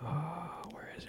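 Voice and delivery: whisper. Real, not acted. Sudden unvoiced whisper.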